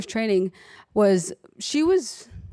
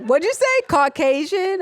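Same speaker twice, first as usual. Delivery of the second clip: much higher and louder, incredulous repeat of Caucasian?; shocked disbelief; 10 semitones higher, 4 dB louder than usual. Retold or imitated. Nurse repeats the patient's word in shocked disbelief. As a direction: echo Caucasian? up high, as if you misheard. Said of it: "I was like".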